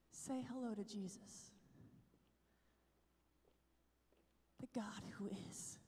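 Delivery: hushed, breathless whisper before the altar; reverent whisper. Retold or imitated. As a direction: whisper it like you're in a holy place. Nun kneeling before the tabernacle.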